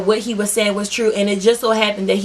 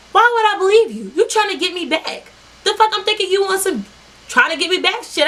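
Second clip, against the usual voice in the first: higher-pitched, incredulous challenge to the ex; indignant retort; 9 semitones higher than usual. Retold or imitated. Telling off her ex for warning her, indignant. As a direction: throw it back at him in a high, indignant voice.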